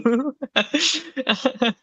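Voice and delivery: a real high burst of laughter. Real, not acted. Guest laughs at the host's robot impression, high and open.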